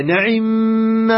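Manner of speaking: نعم drawn out as na'immaa; fatha nun, kasra ayn. Shown as a demonstration. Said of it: "هكذا يقرأوها".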